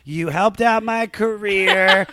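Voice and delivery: slow, loud, high ceremonial voice; mock solemn speech. Honoring friends at his bar mitzvah candle lighting, slow and hammed-up. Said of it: "when I'm like".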